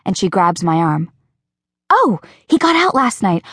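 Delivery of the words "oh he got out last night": higher, lightly voiced girl, sudden concern; Rachel's voice. Rachel realizing her friend's bad night, higher and concerned. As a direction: catch yourself with sympathy.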